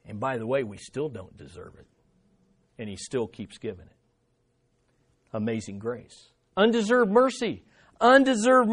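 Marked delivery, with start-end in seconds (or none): 0.0-6.3 hushed aside. Acted.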